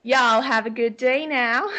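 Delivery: Aussie doing a folksy Texan goodbye; Texas accent. Shown as a demonstration. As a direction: Texas accent tried on for fun. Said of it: "can we hear your Australian Texas accent".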